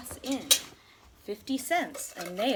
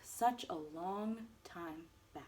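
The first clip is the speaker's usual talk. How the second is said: plain reading voice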